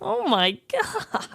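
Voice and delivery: high, slow, hushed "oh my God"; retold shocked gasp. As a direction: mimic the officers realizing it's real. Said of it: "they went".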